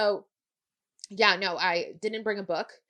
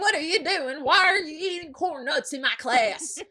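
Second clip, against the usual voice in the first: loud, high, slow Southern outburst; hammed-up exasperated Southern teacher; heavy Southern accent; 9 semitones higher, 6 dB louder than usual. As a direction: mimic a Southern teacher wailing in protest. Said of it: "heavy Southern accent too".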